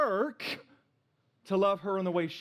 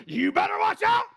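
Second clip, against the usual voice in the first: high, loud scolding yell; grandma's warning shout; 8 dB louder than usual. Retold or imitated. Grandma scolds fighting grandkids with a high, loud shout. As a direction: shout it like grandma catching naughty kids. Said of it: "she would say it like this".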